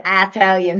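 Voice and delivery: "Italian" with a long first vowel, Eye-talian; alternative pronunciation. Shown as a demonstration. Host denying she says it, alternative pronunciation of "Italian" as Eye-talian. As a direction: stretch the opening of "Italian" into "eye". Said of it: "sounds like there should be an A".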